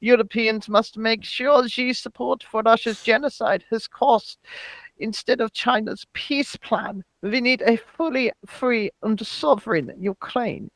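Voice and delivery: softly spoken mock German accent. Host reading a politician's tweet, comic German accent. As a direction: read it in a put-on German accent, with a closing "yeah". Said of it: "we want to do it in the German accent".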